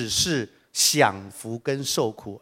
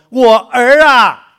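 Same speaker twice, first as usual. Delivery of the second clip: much higher and louder, drawn out "我儿啊"; Abraham answers; 9 semitones higher, 14 dB louder, slower than usual. Acted. Abraham answers the rich man with a loud drawn-out call. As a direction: call out "my son" slowly, grandly. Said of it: "亚伯拉罕说".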